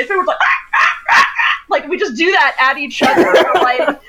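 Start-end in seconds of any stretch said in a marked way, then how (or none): 0.4-1.2 series of short high squawks; raptor signal call. Shown as a demonstration. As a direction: repeat short, high-pitched raptor barks. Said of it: "When I actually, like, was trying to say".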